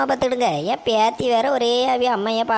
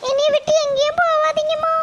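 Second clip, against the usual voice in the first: put-on pleading little girl. Granddaughter begging her mother not to leave, in a high child's voice. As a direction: beg like a small child missing her mum. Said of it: "பாப்பா கூட்டிட்டு வாம்மா".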